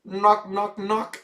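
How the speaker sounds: playful knock knock. Pretend. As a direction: tease him like knocking on his door. Playfully calling for absent player.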